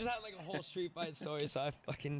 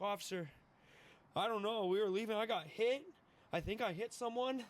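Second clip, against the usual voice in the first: higher, would-be innocent excuse; playing innocent; 6 semitones higher than usual. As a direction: plead your case to the officer, pitched up.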